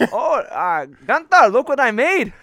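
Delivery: high, loud hammed-up German accent; horrible German accent. German lab scientist showing Gunther his creation, hammed-up German accent. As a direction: call out as an excited mock-German scientist. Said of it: "That's a horrible German accent".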